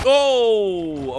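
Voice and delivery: sudden high startled shout; startled yelp. Real, not acted. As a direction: jolt up into a loud yelp. Caught by game attack.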